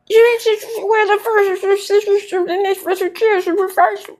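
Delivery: high mock voice. Vlogger mimicking Trish's answer in a high voice. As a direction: pitch way up to mimic her flustered answer. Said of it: "mocking Trish's answer".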